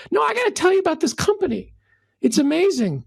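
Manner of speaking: high, fast, fired-up insistence; insistent excitement. Retold or imitated. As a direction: push the story on him, gushing.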